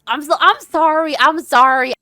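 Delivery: real shocked yelling apology; panicked apology. Real, not acted. Panicked reaction to game slap.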